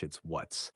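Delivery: "what's" said with a clear short vowel and a final ts, not watch; soft, careful "what's" with the ts sound at the end; gentle model of "what's". Shown as a demonstration. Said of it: "It's not watch".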